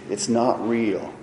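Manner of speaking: ordinary delivery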